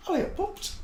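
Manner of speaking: cooing call to the dogs; high sing-song call. Real, not acted. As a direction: lilt it up high to get the dogs moving. Calling the dogs up.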